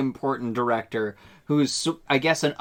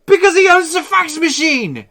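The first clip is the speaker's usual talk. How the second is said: high, loud mock-outraged shout; hammed-up righteous outburst; indignant shout; 13 semitones higher, 9 dB louder than usual. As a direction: yell it like it's obvious. Acted quote, absurd outburst.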